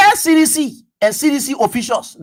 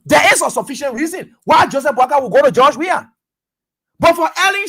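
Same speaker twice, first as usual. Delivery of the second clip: usual speaking voice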